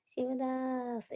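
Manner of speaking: long, loud drawn-out name call. Real, not acted. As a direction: hail the newcomer, stretching the name. Calling out to an arriving viewer. (ഉച്ചത്തിൽ നീട്ടി വിളിച്ച്)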